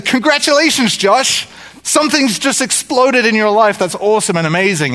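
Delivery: high-pitched, elated cheer for a winner; excited congratulations. Acted. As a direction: burst out congratulating a lucky friend. Acted cheering of a lottery winner.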